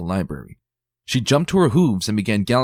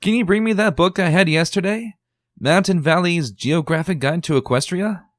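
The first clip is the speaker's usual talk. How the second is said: calling request; 11 semitones higher, 4 dB louder than usual. Acted.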